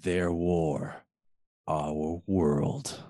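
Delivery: low, slow trailer read; deep dramatic trailer announcer; movie trailer voice. Acted. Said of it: "just gonna do it in different voices".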